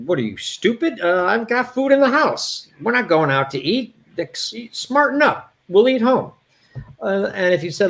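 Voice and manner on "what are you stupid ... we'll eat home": higher, brusque, scolding; imitating the brother scolding the speaker; gruff brother. Higher brusque scolding. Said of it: "he would say".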